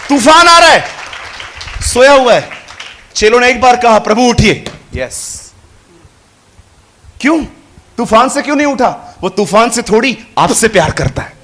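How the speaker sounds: loud, high, rousing sermon; full-voiced pulpit fervour; fervent preaching. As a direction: preach it to stir the crowd.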